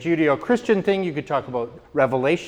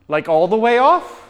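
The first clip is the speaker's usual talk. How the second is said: incredulous question; 6 semitones higher, 8 dB louder than usual. Retold or imitated. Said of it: "And I'm like".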